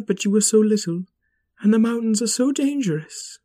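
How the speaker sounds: a protective mom explaining gently; mother rabbit voice. Acted.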